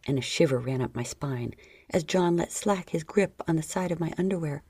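ordinary delivery

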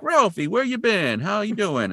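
high singsong greeting; hammed-up daydream parents at the door; gushing parents.

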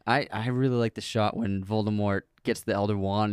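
usual speaking voice

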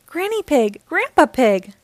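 high, eager calling out; excited cry. A narrator's reading. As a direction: call out like a delighted child. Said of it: "cried Peppa".

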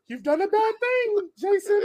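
very high squeaky cartoon mouse; Mickey Mouse voice. Mickey threatening the hosts, in a squeaky menacing mouse voice. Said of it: "as he's talking to you".

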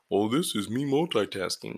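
mock smug braggart voice. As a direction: brag with a smirk. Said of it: "not like".

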